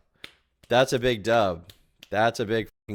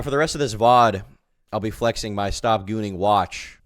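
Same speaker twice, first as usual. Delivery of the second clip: usual speaking voice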